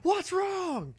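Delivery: high-pitched startled yell, held in volume; mock yell. Mom jolted from dozing by TV, high mock yell. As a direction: yelp it like someone jolted awake. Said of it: "I leapt up yelling".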